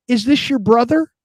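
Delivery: high imitated questioning voice; innocent nurse. Visiting nurse asks patient about son, innocent nurse. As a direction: ask it high and guileless.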